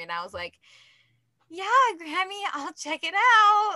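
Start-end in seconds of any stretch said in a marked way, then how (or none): 1.5-3.8 mock agreement. Mock reply to grandma's recommendation.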